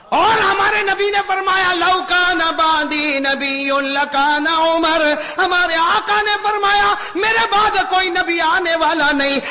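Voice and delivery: slow, high, loud, solemn proclamation; solemn full-force preaching. Acted. Preacher proclaiming the Prophet's praise of Umar, slow solemn preaching. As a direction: proclaim it like a verdict.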